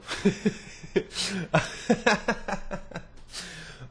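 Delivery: high, drawn-out laughing fit; long laugh. Real, not acted. Host joking about friend named Liam, long high laugh. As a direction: crack up at your own joke.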